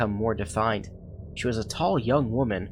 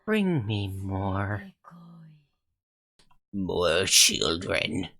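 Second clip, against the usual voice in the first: unearthly voice; much slower than usual. Acted. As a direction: drag it out like a hungry spirit. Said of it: "An unearthly voice reverberated".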